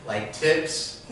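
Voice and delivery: ordinary delivery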